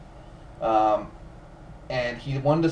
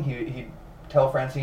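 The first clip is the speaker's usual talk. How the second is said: usual speaking voice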